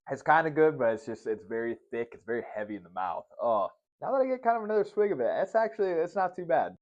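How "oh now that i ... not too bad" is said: pleasantly surprised. Real, not acted.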